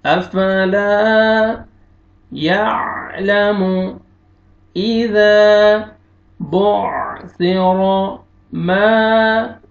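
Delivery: melodic Quran recitation. Acted. Teacher reciting with growing fervour. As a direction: chant the verses slowly, rising in force.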